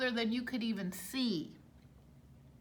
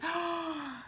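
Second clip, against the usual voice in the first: gasp; 6 semitones higher, 4 dB quieter than usual. A narrator's reading. Shocked gasp.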